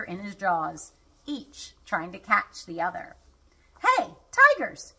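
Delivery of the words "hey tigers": high, loud, drawn-out call. A narrator's reading. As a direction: holler to the tigers from afar. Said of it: "Sam called".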